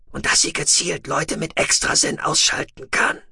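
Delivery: a breathy, half-whispered alien voice; Shallowayne's voice. A narrator's reading. Shallowayne telling Tech it is too late, in a hushed alien whisper. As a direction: hold him in a hushed, husky whisper.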